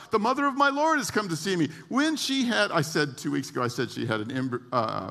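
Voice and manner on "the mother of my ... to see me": high-pitched, mildly raised exclamation; retold cry, pitched up; exclaimed quote. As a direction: call it out high in mock astonishment. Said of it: "Elizabeth shouts out".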